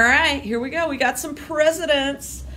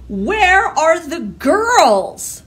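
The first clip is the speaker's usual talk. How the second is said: shocked outcry; 6 semitones higher, 7 dB louder, much slower than usual. Acted. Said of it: "could not believe her eyes".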